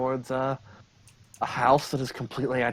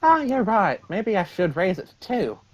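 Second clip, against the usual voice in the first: higher, lilting Southern belle; attempted Eddie, turning Southern belle; 7 semitones higher than usual. Lemur raising the taco price, high Southern belle. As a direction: pitch it up into a teasing Southern belle. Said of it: "I'm trying to do an Eddie voice, and I keep turning into a Southern Belle".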